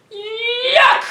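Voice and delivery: high loud shouted yuck; squealing yuck of disgust. Acted. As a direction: recoil in mock disgust. Monster's disgusted cry.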